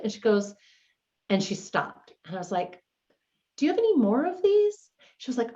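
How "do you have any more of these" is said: gentle probing. Retold or imitated. Organizer gently asking client about hidden cords, careful and high. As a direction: ease the question in delicately.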